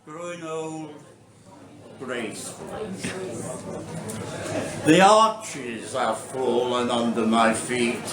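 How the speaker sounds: deliberate verse recital in short phrases; poetry reading. Acted. Poet reading about growing old to an audience, measured recital. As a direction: announce the title, then recite line by line. Reads own poem aloud.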